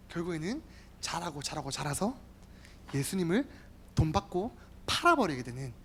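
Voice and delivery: ordinary talk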